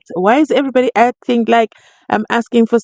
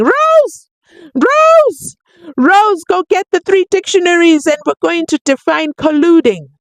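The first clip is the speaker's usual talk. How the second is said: mock shouting judge; 10 semitones higher than usual. Judge yelling at advocate Rose, mock shouting. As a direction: bellow it like an exasperated judge.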